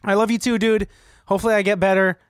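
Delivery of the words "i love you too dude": ordinary talk, nothing marked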